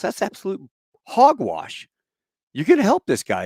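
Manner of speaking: high, loud acted-out outrage; indignant retort. Bill answers the doctor who gave up, indignant. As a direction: throw the refusal back at him.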